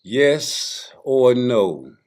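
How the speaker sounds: loud, high, very drawn-out challenge; game show announcer. Acted. Host launches a round of the game, loud announcer call. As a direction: call out each choice slowly to the audience. Poses the game's question.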